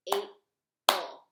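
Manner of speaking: "April" split in two loud beats, A-pril; clapped syllables. Shown as a demonstration. Teacher clapping the syllables of April for kids, loud. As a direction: clap out "April" loud, one beat per part. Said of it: "Let's clap that one".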